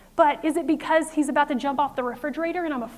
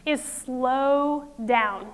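slow, drawn out; much slower than usual. Shown as a demonstration. Teaching the acronym, slowly drawn out. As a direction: stretch each word out slowly. Slowed, drawn-out key point.

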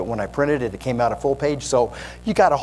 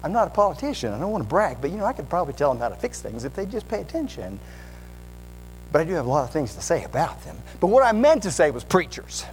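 bragging know-it-all; 6 semitones higher than usual. Acted. Preacher still playing the braggart.

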